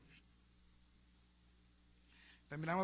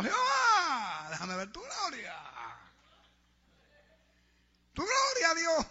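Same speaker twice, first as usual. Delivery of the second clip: high, wailing mock plea with stretched vowels; shouting at the altar; 8 semitones higher, 7 dB louder, much slower than usual. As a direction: cry out to God in hammed-up fervor. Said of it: "gritando en el altar". (lamento fervoroso imitado, a gritos)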